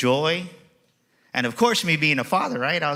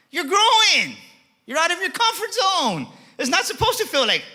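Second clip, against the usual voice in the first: preaching climax. Acted. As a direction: lift it up to the crowd.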